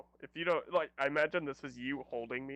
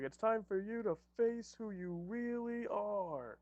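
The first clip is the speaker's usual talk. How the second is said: higher, slow, drawn-out declamation; dramatic hero speech; 6 semitones higher, slower than usual. Acted. Player confronts the villain who rejected him, heroic declamation. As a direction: proclaim it like an anime hero.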